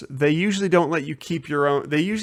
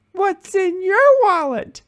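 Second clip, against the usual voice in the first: put-on very high, drawn-out toddler voice; squeaky little-kid impression, slow; Charlie; 4 dB louder, slower than usual. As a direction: imitate a two-year-old proudly delivering a tagline. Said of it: "little two and a half year old Charlie".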